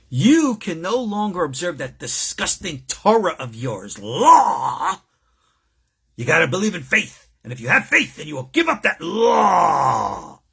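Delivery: hammed-up outraged shout of a preacher demanding they drop the law. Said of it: "That's how they talk".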